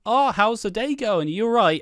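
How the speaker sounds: chirpy, high-pitched acted chit-chat; high, loud mock pleasantries; oblivious small talk. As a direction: play a clueless character making small talk. Oblivious characters chatting over tea.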